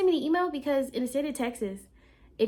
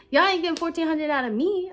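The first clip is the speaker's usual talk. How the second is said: louder, higher defiance; flatly refuse, raised voice; defiant; 6 semitones higher, 6 dB louder than usual. Retold or imitated. Refusing to pay.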